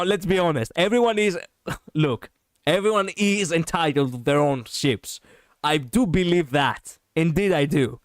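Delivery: ordinary talk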